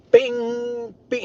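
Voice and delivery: high ringing pinball bing; ping it out like a pinball bell. Retold or imitated. Copying a pinball machine for viewers, high ringing bing.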